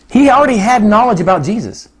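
ordinary talk

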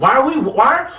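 usual speaking voice

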